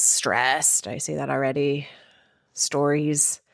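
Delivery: ordinary delivery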